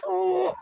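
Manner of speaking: high, soft closed-mouth whimper; mock whimper. Actor crying after being picked on, mock whimper. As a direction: imitate a pitiful held-in cry. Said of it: "you know, I'm crying".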